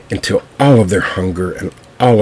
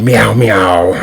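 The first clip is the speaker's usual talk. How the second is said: louder mock meow; cat meowing; 3 semitones higher, 6 dB louder than usual. A narrator's reading. Cat joins the yell to scare robbers, meowing. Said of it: "the cat said".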